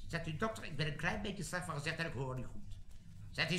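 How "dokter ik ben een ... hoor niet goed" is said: low, very soft complaint; meek sickly recruit. Acted. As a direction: plead poor health in a small, low voice. Recruit pleads ailments to doctor.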